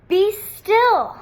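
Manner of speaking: high, loud kingly order; boy king silencing the beasts; commanding. Acted. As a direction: command the monsters to freeze. Max commands the wild things.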